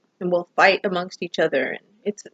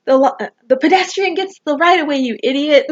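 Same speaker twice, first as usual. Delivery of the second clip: retold angry yell; 5 semitones higher, 3 dB louder than usual. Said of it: "I yelled out something to her".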